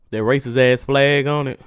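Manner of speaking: ordinary talk, nothing marked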